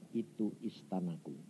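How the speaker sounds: very soft, low answer; voice of God. Retold or imitated. Preacher voices God naming the palace, hushed and low. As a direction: state it quietly and deep. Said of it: "Tuhan berkata".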